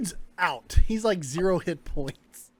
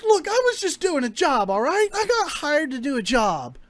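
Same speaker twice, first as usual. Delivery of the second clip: higher-pitched, defensive excuse-making; flustered pleading; 6 semitones higher, faster than usual. Acted. Hired man explaining himself to his interrogators, flustered and defensive. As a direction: plead your innocence in a raised voice.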